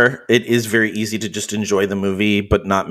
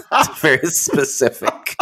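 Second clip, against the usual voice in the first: higher, amused and breaking into laughter; laughing; 11 semitones higher, slower than usual. Real, not acted. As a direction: laugh through the words, slowly. Laughs through her retold reply.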